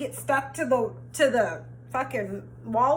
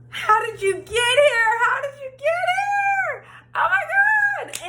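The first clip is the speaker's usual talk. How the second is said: overjoyed shout; 14 semitones higher, slower than usual. Retold or imitated. Friend suddenly arrives with car.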